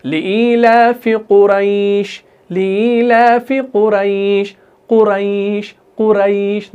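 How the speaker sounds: long, level, drawn-out "ai" in कुरैश; slow chanted कुरैश, gliding gently through the "ai"; soft leen recitation. Shown as a demonstration. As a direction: stretch कुरैश softly on a steady tone. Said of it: "नरमी से अदा कर रहे हैं".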